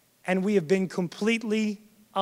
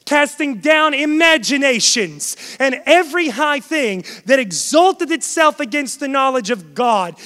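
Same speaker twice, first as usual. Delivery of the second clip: emphatic preacher's recitation, key words punched; forceful scripture preaching; 4 semitones higher, 6 dB louder than usual. Acted. Preacher reciting scripture on spiritual warfare to the congregation, forceful. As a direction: declaim the verse to a congregation.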